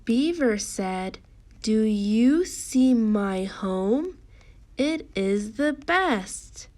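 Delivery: slow, projected storybook reading; drawn-out teacher reading with lifted pitch at the close; emphatic read-along. Shown as a demonstration. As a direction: read out slowly and strongly, word by word.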